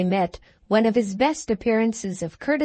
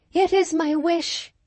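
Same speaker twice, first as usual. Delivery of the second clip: much higher, delicate character voice; Katrina's voice; 10 semitones higher than usual. A narrator's reading. Katrina consents to the seal being broken, high-voiced. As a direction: voice a young woman in high pitch.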